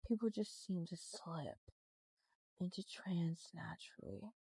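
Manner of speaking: hypnotic voice. Shown as a demonstration. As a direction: lull them into trance. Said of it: "when I talk a certain way".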